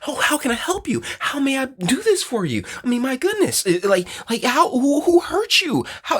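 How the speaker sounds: very high, fawning mock concern; doting rescuer. Men rush to comfort a crying woman, high fawning mock. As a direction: gush over her like a smitten helper. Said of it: "when a woman comes around crying".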